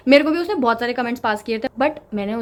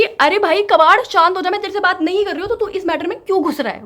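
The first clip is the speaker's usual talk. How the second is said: angry scolding; 7 semitones higher, 4 dB louder than usual. Retold or imitated. Narrator scolding Kabad for butting in, loud high anger. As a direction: snap back at the pest.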